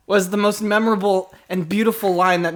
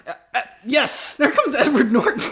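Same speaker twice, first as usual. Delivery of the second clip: high, giggly swooning fan; giddy fan; 5 semitones higher than usual. Acted. Fan giggling as she meets Edward Norton.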